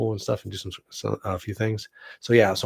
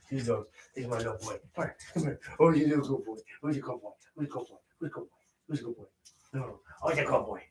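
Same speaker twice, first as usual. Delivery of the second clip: soft, higher-pitched cooing to a dog; doting pet talk; 3 semitones higher, 8 dB quieter, faster than usual. Real, not acted. Owner greeting his little boy Vader, soft doting baby talk. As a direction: coo quiet praise over and over to your pup.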